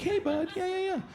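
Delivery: put-on very high coaxing baby-talk; squeaky, friendly greeting to a little dog; inviting dog voice. Shown as a demonstration. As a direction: coo at a dog in a tiny high voice. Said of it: "I have a dog voice".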